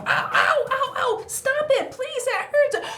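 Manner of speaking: very high, loud hammed-up ow; mock pain yelps. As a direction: squeal at each peck, begging it to stop. Crow pecks her again.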